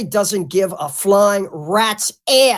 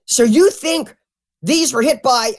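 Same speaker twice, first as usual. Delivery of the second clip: excited interviewer imitation; 4 semitones higher than usual. Mimicking a host excitedly questioning his guest, excited. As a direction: pitch it up like a thrilled host. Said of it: "he got so excited".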